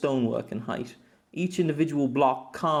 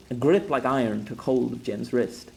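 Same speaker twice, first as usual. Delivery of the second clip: usual speaking voice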